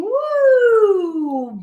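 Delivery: high, swooping woo; bouncing whoop. Acted. Bear flies off the trampoline, high swooping whoop. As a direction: whoop up and slide down.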